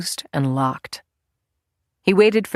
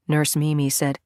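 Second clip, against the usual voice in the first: ordinary delivery, nothing marked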